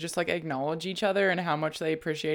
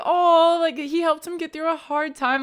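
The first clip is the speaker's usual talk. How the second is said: squealing fan, much higher and louder; mock fan gushing, pitched way up and loud; a fan swooning over a sweet interview, high and loud; 10 semitones higher, 7 dB louder, slower than usual. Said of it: "And then you're like".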